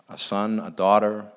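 ordinary talk, nothing marked

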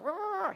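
high, throwaway monster "rah"; mock demon roar. Preacher parodying a satanic attack, mock high roar. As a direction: imitate a possessed creature's roar. Imitates a demon-possessed person.

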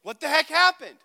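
loud, high acted exasperation; frustrated. Student confronting friend after missed test, loudly frustrated. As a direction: burst out at a friend in retold frustration. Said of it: "I'm frustrated".

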